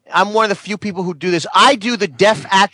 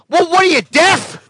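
mock Jerry; 7 semitones higher than usual. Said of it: "Jerry says to her".

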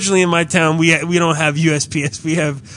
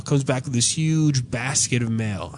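normal talking voice

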